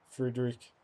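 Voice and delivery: Friedrich in a mock German accent, r rolled from the throat; a quick, hammy German pronunciation of Friedrich; bad German accent. Gamer correcting himself in a bad German accent. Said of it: "my bad German accent".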